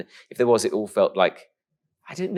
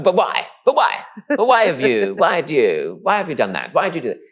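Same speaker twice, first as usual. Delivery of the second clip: high, repetitive mock nagging; pestering questioners; 7 semitones higher, 5 dB louder than usual. People demanding reasons from a boy, pestering. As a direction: start high and loud, easing as the questions pile up. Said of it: "people love to go like".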